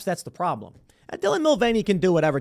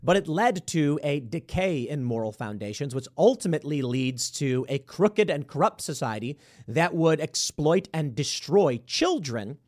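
usual speaking voice

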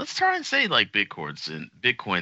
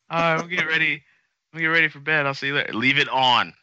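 put-on higher, broad Boston accent; 6 semitones higher than usual. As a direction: play a Boston guy heading to bed. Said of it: "with a Boston accent".

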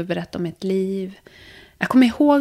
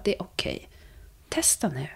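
soft reassurance; 3 semitones lower, 8 dB quieter than usual. Retold or imitated. Teacher's silent encouragement voiced.

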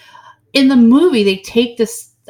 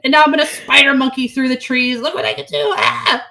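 hammed-up vampire outburst, higher and louder; mock melodramatic rage; 7 semitones higher, 4 dB louder than usual. Mocking movie vampire yelling at her to look. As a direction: overplay a tortured monster shouting. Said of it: "they turn it into this".

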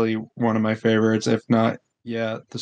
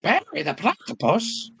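a lightly voiced cartoon Perry, much higher; Perry impression; 9 semitones higher than usual. Friends cheer a voice impression, lightly voiced higher cartoon. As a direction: toss off a quick cartoon impression. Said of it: "That was my best Perry impression".

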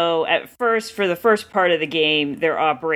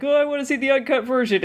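high, quick mimicry of eager fans; mock eagerness; 9 semitones higher, faster than usual. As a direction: put on a high, hurried fan voice. Mimicking naive eager audience.

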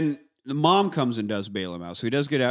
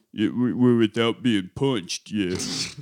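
low, slow, dopey-boxer answer; Rocky impression; 5 semitones lower, slower than usual. Rocky answering the doctor in hospital, low slow impression. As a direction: drag it out low, as the old boxer.

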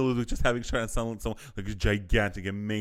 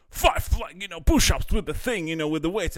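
coach voice; 8 semitones higher, 5 dB louder than usual. Acted. Voicing coach, loud high.

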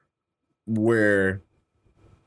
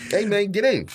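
bad Italian mobster accent; a weak attempt at an Italian accent; 11 semitones higher than usual. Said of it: "My Italian accent is horrible".

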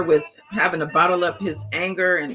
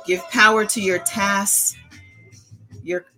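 calm meditative tone. Acted. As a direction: guide them gently into calm breathing. Leads a guided breathing meditation.

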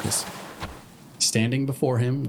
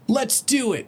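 high excited shout; 13 semitones higher than usual. Acted. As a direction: cheer it out eagerly. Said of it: "shouted Rainbow Dash".

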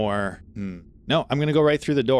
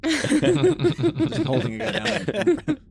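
laughing; 3 dB louder, much slower than usual. Real, not acted. Laughing joke about poisoning.

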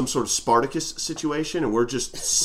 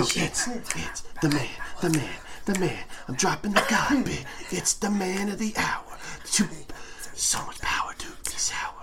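hushed, suspenseful whispered introduction; whispering; 10 dB quieter than usual. Real, not acted. Host introduces the man of the hour, whispering. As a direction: whisper it like a secret build-up. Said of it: "get some whispers in the background".

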